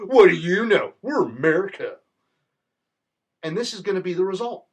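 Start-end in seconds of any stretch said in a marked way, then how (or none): 0.0-2.0 loud, cocky put-on voice brushing off advice; mock arrogant dismissal. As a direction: swagger it like a smug bully. Mocking arrogant America.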